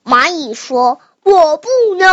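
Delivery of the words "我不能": ant's refusal. Acted. Child as the ant telling the cow no, loud refusal. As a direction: call it out high and loud.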